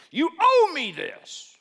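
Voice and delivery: high, loud, slow entitled boast; swaggering general demanding his due; arrogant demand. Retold or imitated. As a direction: puff out your chest and demand it. Naaman strutting, demanding.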